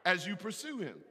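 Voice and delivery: loud, high, ringing preacher's call; preaching at full force. Real, not acted. Pastor calling the congregation to move out with God, full-force preaching. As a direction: drive the charge home to the crowd.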